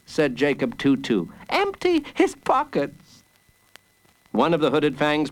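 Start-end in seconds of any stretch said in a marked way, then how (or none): 1.5-3.2 put-on very high little boy's voice; high-pitched boyish command; child hero's order. A narrator's reading. Child hero repeats his order.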